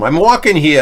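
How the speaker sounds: loud New York street shout on "I'm walking here"; New York accent. Shown as a demonstration. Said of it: "with a New York accent?".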